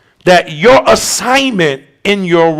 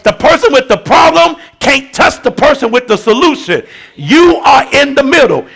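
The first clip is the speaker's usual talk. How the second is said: steady, emphatic sermon teaching; preaching; 5 semitones higher than usual. Acted. Pastor explaining intercession to the church, emphatic. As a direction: hammer home the picture of the gap.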